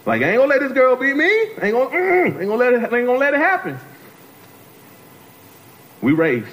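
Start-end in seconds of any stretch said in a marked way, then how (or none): 0.2-3.8 high, worked-up refusal to lose; acted-out competitive indignation, pitched up; defiant vow. [name] refuses to lose the race, defiant. Young self vows to win race.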